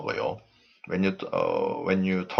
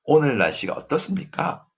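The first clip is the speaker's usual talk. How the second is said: higher pitch, climbing to the end of "어떻습니까"; rising intonation; 6 semitones higher, much faster than usual. Shown as a demonstration. Teacher demonstrates Korean intonation, rising high on "어떻습니까". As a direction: lift "어떻습니까" clearly upward. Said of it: "goes up".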